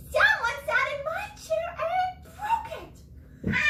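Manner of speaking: very high, slow squeaky little bear; high-pitched wailing Baby Bear; Baby Bear upset. Acted. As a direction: squeak it out like a tiny upset cub.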